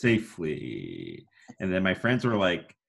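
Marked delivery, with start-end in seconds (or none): 0.0-1.2 much lower, very slow final word; dramatic pause drop. Shown as a demonstration. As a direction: land the word after a long pause. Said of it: "and then there's a pause".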